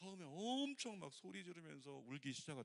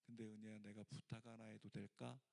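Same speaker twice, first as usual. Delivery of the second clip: gentle request; 4 semitones lower, 6 dB quieter than usual. Retold or imitated. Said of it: "하나님께서 뭐라고 하셨냐면".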